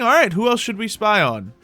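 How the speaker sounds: ordinary talk, nothing marked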